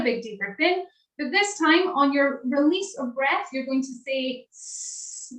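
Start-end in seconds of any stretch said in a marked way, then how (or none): 4.5-5.3 drawn-out unvoiced hiss; snake hiss. Shown as a demonstration. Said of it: "like a snake".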